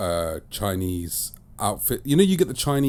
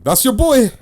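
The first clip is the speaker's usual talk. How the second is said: high, loud, drawn-out shout-out; big up your co-host loudly; stretched, pitched-up hype announcement; mock hype man; 10 semitones higher, 6 dB louder, slower than usual.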